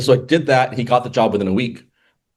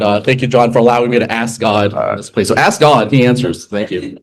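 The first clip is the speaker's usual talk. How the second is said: normal talking voice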